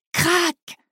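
longer high-pitched crack; imitated cracking roof. A narrator's reading. Storyteller as the thatched roof splits, drawn-out high crack.

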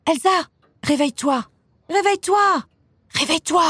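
high, urgent pleading to wake up; insistent waking call. A narrator's reading. Little sister climbing on the bed to wake Elsa, insistent. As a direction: pester a sleeper with rising insistence.

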